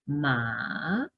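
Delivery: "Ma" dropped low, then swept upward; dipping third tone. Shown as a demonstration. Teacher showing what the voice does, falling-rising "Ma". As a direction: sink low on "Ma" and rise. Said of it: "o sea, hago esto".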